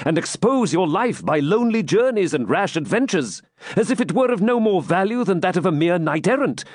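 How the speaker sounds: high, impassioned appeal; urgent, high-pitched reproach from a loyal knight; earnest pleading. A narrator's reading. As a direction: plead with your prince to take care. Knight pleads with his king.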